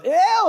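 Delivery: loud, high-pitched "ew" of revulsion; piercing mock squeal of disgust. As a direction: imitate a grossed-out kid crying out. Retold child's disgusted reaction.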